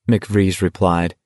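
plain reading voice

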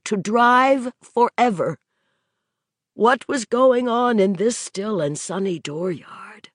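plain reading voice

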